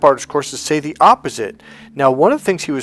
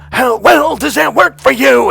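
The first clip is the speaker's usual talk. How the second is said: much higher and louder, near sung; yelled; 11 semitones higher, 9 dB louder than usual. Retold or imitated. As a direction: yell it to snap him out. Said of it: "I literally yelled at him".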